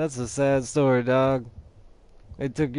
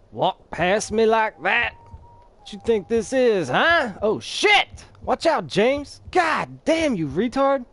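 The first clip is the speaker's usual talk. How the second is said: taunting yell; 8 semitones higher, 4 dB louder than usual. Acted. Yelling at enemies in fight.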